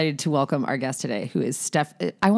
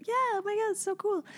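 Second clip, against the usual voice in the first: high, hushed mock-excited squeal; retold gushing; 7 dB quieter than usual. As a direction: imitate gushing in a small, very high voice. Retold family gushing over the magazine.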